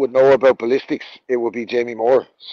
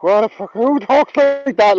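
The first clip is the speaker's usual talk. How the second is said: incredulous; 6 semitones higher, slower than usual. Real, not acted. Incredulous at Garcia's tweet.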